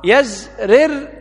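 يزرر said slowly as yazrir, the ra with an i; yezrir with kasra. Shown as a demonstration. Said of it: "بكسر الراء".

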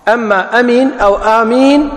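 أمين and آمين said carefully with a plain م, one short alif and one long; correct forms shown. Shown as a demonstration. Teaching students, the correct أمين and آمين with a plain م. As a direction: spell out أمين then آمين, a light م in each. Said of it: "فهو اسم فعل".

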